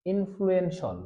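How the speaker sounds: careful in-flu-en-shol; model pronunciation. Shown as a demonstration. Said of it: "এটাকে আমরা উচ্চারণ করব সল".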